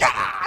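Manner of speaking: sudden high yelp of pain; hammed-up pained yell; mock scream. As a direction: cry out as if just hit. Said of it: "He starts screaming".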